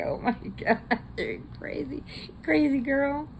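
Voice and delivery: high, laughing through the words; amused and delighted; high-pitched laughing scold. Real, not acted. Laughing at pirate toddler.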